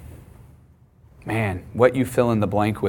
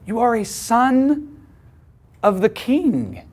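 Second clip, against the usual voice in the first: higher, slow, each word weighed; emphatic affirmation; 11 semitones higher, much slower than usual. Retold or imitated. Father telling his son who he is, higher and slow.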